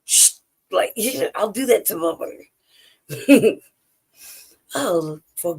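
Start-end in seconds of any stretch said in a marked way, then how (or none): 0.0-0.3 short hushing "shh"; mock shushing. Telling a loud opponent to calm down, teasing shush. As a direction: hush them as a tease.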